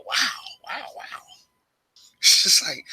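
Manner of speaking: breathy hushed wows that sink almost to nothing; awed whisper. Real, not acted. Vlogger marvelling to viewers at mortality, in a hushed whisper. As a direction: whisper it in awe, as if struck by mortality.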